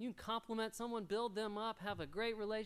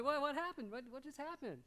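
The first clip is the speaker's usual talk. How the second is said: mock puzzled dad; 7 semitones higher than usual. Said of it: "I'm like".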